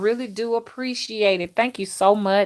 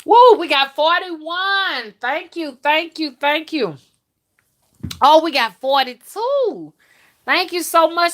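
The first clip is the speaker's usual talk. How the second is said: high, loud happy cheer; excited cheering; 8 semitones higher, 5 dB louder than usual. Real, not acted. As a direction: celebrate every new thumbs up. Cheering as numbers climb.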